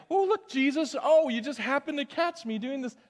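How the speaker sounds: high, hammed-up feigned surprise; mock surprise. Believer pretending Jesus caught him doing good, mock surprise. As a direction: act caught in the act, faking innocence. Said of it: "and he".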